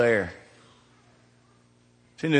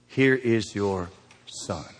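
tender, slow; 5 semitones lower, 6 dB quieter, slower than usual. Retold or imitated. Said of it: "When Jesus saw his mother".

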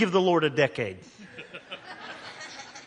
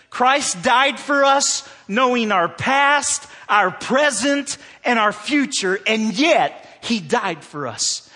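preaching climax; 6 semitones higher, 6 dB louder, slower than usual. Acted.

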